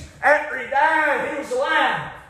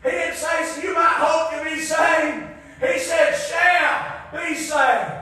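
full-force preaching; 5 semitones higher, slower than usual. Acted. Preacher exhorting the congregation, full-force preaching.

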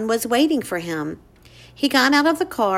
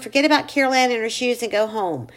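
ordinary delivery, nothing marked

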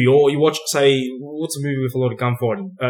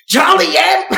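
high, loud, furious mob shouting; Joe Pesci rant; 5 dB louder than usual. Retold or imitated. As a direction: scream it like an unhinged gangster losing it. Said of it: "while he's just like".

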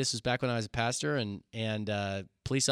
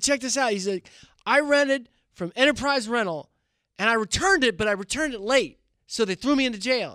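high bragging inmate; 10 semitones higher, 4 dB louder than usual. Retold or imitated. The inmate boasts about the car, in a high voice. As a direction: brag like the car borrower.

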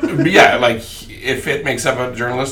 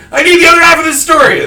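loud, high demanding yell; shouting; 9 dB louder than usual. Retold or imitated. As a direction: yell it in a panic. Kane retold demanding the paper.

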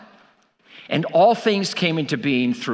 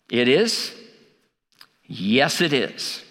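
normal talking voice

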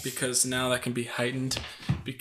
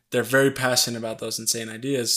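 usual speaking voice

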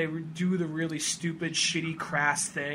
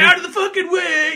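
much higher, much louder cartoon yell; Sonic shouting; 13 semitones higher, 13 dB louder than usual. Sonic runs through the town, high loud yell. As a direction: clear the street at full speed. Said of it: "just running".

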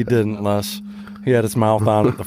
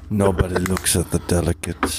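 lower, comic Russian warning; Russian accent; 5 semitones lower than usual. Acted. Russian Kool-Aid guards his delicates, low Russian accent. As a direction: lay down rules in a Russian accent. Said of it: "His delicates is his cocaine".

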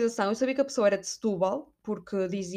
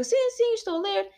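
much higher, chirpy put-on voice; mock girly self-quote; 10 semitones higher than usual. Answering the boy on the metro, high and chirpy. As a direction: chirp it back like a flustered girl. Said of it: "E eu".

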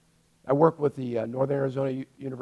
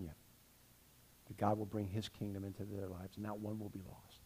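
hushed prayer; 7 semitones lower, 8 dB quieter than usual. Real, not acted. Quiet personal prayer reflection.

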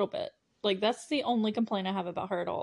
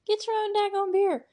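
put-on much higher, louder sassy girl voice; mock indignant retort; 12 semitones higher, 4 dB louder than usual. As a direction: fire it back like an offended girl. Tatum snapping back at Stu.

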